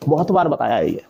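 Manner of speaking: usual speaking voice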